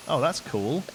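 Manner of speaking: ordinary talk, nothing marked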